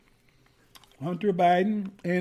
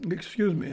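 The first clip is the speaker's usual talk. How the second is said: chesty cough. Real, not acted. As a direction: cough from an itchy throat. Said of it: "I've got this cough again".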